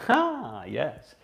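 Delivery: ordinary delivery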